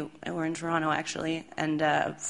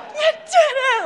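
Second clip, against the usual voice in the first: very high, loud, laughing shout; overjoyed cheer; 8 dB louder than usual. Retold or imitated. Band cheering the bassist holding up the keys, overjoyed. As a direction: cheer him like he won a war. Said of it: "Like, so happy".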